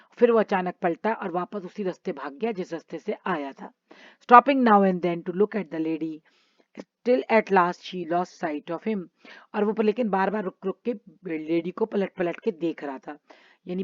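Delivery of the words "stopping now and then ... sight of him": each word spaced out for the class; slow story reading. Acted. Teacher reading the story to children, slow and clear. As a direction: read the English line slowly and clearly, like a storybook. Reads English story text.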